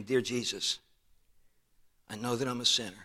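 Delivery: soft, weary little retold prayer; weak, tired voice. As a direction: lightly imitate a frail, tired woman praying. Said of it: "this weak, tired little voice".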